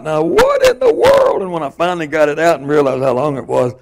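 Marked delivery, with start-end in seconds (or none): 0.0-1.4 much higher, slow, astonished; amazed exclamation. Retold or imitated. Pulling out the snake, astonished and high. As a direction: stretch it out in disbelief. Snake keeps getting longer.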